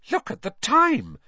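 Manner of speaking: high, loud, startled cry; sudden exclamation. A narrator's reading. Mother checking her watch, exclaiming suddenly. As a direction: break in abruptly with alarm at the hour. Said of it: "Missus Weasley said suddenly".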